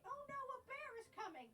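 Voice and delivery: high, loud fake panic; mock alarm. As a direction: shriek it like a bear's coming.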